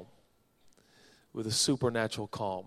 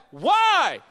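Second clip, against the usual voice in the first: high, loud, incredulous holler; yelled comeback; 11 dB louder than usual. Acted. Man in the boat answers Jesus, yelling back. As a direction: yell it back across the water. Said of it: "I'd yell back".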